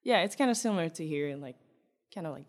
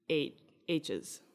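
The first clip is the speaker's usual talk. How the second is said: normal talking voice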